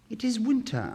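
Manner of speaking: high, bright-pitched reply; high swallow voice. Acted. Swallow answering the prince, pitched high. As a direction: plead the season as the reason. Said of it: "answered the swallow".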